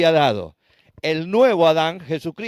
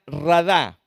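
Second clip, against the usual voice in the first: radá with stress on the last syllable, "dá"; stress on final A. Shown as a demonstration. Preacher teaches the Hebrew word for rule, radá stressed on final A. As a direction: punch the closing A of radá. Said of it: "con acento en la A".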